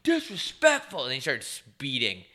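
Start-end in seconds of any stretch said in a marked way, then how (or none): 0.0-1.1 drawn out, higher and louder in disgust; imitating an offended driver; mock outrage. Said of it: "He's like".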